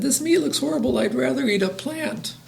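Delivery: high, soft little voice; baby dinosaur. A narrator's reading. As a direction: refuse dinner as a timid baby dinosaur. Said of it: "And he said".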